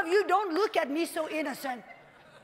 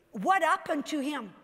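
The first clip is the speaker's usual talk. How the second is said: normal talking voice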